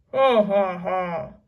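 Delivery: booming captain's guffaw; loud pirate laugh. A narrator's reading. Captain laughing at his prisoners, loud guffaw. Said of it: "laughed loudly".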